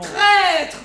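angry. Acted. Mother furious at Molière.

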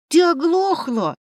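high, loud, exasperated roar; growled. A narrator's reading. Familiar outside the door to Liza, roaring crossly. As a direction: burst out at someone not listening. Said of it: "послышался рык".

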